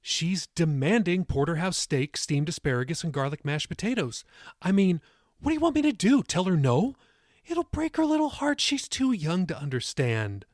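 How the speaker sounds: high-pitched comic pleading; playful mock protest. A narrator's reading. Molly blames the baby's appetite, high playful protest. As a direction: plead the silly excuse in a lifted voice.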